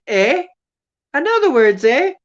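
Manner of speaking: high, loud hammed-up Canadian "eh?"; mock Canadian accent. Goose answering the speaker, in a loud mock Canadian accent. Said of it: "No, they didn't say that".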